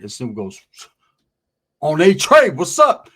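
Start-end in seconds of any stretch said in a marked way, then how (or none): 1.8-3.1 loud, ready-to-fight greeting; defiant call-out. Retold or imitated. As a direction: throw it back like a challenge. Snoop answering a challenge.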